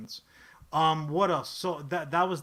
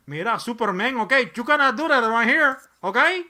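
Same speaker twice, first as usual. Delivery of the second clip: put-on higher, louder Mexican accent, fast and warning; playful villain threat in a Mexican accent; Mexican-accented villain warning Superman; Guillermo del Toro as Lex Luthor; 6 semitones higher, 10 dB louder than usual. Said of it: "Guillermo del Toro is my picks for Lex Luthor".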